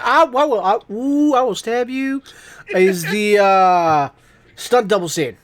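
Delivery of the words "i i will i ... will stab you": mock threat. Jokingly threatening friend in banter.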